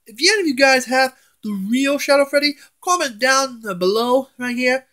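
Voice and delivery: ordinary talk, nothing marked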